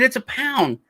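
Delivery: mock insistent yell, high-pitched; retold exasperated correction. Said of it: "we're yelling at each other".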